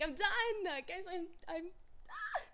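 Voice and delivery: high, loud, done-with-it cry; mock exasperated outburst. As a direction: throw up your hands and wail it. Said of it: "I was like".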